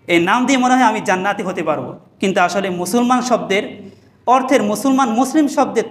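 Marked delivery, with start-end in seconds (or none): none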